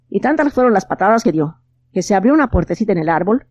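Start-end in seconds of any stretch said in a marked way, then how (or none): none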